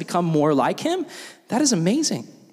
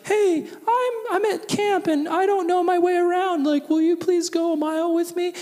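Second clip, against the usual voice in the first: much higher, put-on pleading little voice; timid lost camper; 12 semitones higher than usual. Newcomer at camp asking for company, high mock-timid voice. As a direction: ask meekly in a high mock voice. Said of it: "if someone's like".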